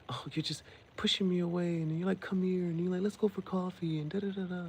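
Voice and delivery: high-pitched imitation of her griping; mocking complaint. Tipsy woman mocks him on the phone, high voice. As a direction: reel off grievances in a high mock voice. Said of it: "So she started mocking him".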